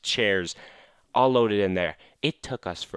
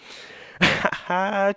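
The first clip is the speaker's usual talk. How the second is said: high giggling laugh; delighted high laugh; high burst of laughter; 11 semitones higher than usual. Real, not acted. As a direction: crack up at your own joke. Laughs after punchline.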